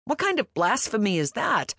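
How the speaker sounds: mock outrage over decaf; scoffing. A narrator's reading. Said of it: "I scoffed".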